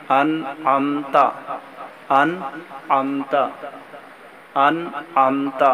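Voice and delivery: انعمت repeated very slowly, each sound clear; slow Arabic pronunciation drill of انعمت; careful pronunciation. Shown as a demonstration. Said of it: "اس جملے کا بھی تھوڑا سا خیال رکھنا ہے".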